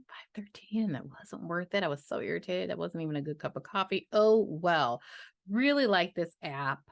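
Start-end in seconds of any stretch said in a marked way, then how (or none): 0.1-1.8 unvoiced whispered grumble; hushed grumbling aside. Real, not acted. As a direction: whisper it to yourself, annoyed. Muttered aside about coffee.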